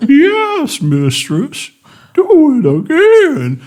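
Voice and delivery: very high, drawn-out whale moan. Acted. Whale servant answering the mistress, whale voice. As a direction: stretch the words into swooping whale song. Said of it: "in a more whale voice".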